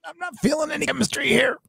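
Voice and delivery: very high, quick squeaky quote; mock complaint. Viewer recalling his reaction to the romance, high mock voice. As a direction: squeak it out fast, far above your own voice. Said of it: "And I was like".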